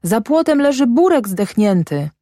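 high-pitched, urgent report; boy calling out. A narrator's reading. Boy tells father about the dead dog, calling high. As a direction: blurt the news high, like a child. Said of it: "wołał chłopiec".

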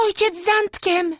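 put-on excited girl's high cry; girl shouting. A narrator's reading. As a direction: call out high like a young girl spotting her father. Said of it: "krzyknęła Józka".

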